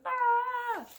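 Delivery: high, sharp startled "Ah!"; startled gasp. Acted. Zeke finding the bug gone, startled. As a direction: jolt up high in alarm. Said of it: "He's like".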